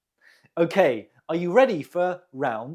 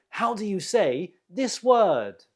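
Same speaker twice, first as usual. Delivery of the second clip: usual speaking voice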